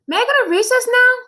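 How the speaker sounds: put-on high, loud whiny kid voice; a boy begging to be let out; child Joe. Boy begs his teacher for recess, high and whiny.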